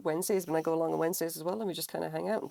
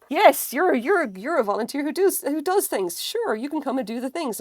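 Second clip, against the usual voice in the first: higher, louder, amused indulgence; mock bemused staff; 9 semitones higher, 6 dB louder than usual. Staff humouring the eager volunteer, mock bemused. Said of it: "they were all just like".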